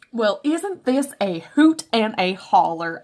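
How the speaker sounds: loud, drawn-out hammed-up Southern twang; put-on country accent. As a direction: lay on a silly, slow hoot-and-holler country accent. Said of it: "I'm so sorry for the accent".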